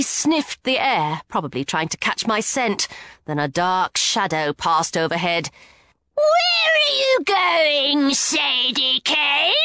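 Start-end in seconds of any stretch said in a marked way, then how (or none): 6.1-9.5 high, slow shriek with an eerie edge; drawn-out shrieking taunt; shrieking monster. A narrator's reading. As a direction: screech it down from above like a hunting bird-woman. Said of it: "Neckbet shrieked".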